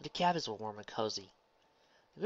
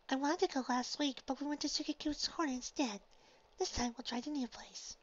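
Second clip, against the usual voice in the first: put-on high, gentle girlish voice; Fluttershy. Fluttershy figure insists.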